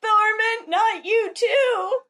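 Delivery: high, drawn-out mock wail; acted anguished cry. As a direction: cry out in theatrical dismay for a fallen friend. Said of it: "And somebody cries".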